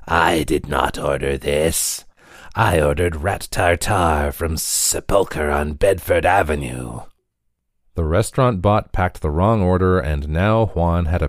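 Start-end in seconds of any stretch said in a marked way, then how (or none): 0.1-7.0 rasping skeletal customer. A narrator's reading. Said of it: "His voice rasped as if he'd swallowed a pound of sand".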